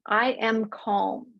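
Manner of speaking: slow, even "I am calm"; slow calm affirmation. Shown as a demonstration. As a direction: say it slowly, settling. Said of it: "the very simple intention".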